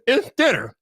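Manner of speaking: high, swooping parody of gushing online fans; mocking hype imitation. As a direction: mimic silly awe, pitch jumping up and down. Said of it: "the only thing that they seem to be saying".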